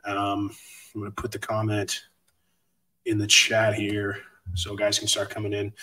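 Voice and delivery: ordinary talk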